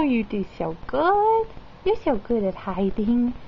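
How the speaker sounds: usual speaking voice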